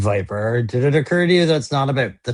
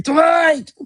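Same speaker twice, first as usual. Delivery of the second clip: mock scream. Imagining Michael Scott screaming for his coworker, high mock scream. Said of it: "have him screaming".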